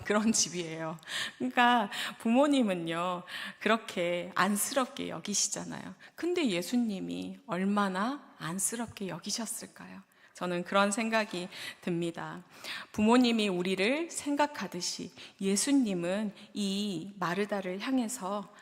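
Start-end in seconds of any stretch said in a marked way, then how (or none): none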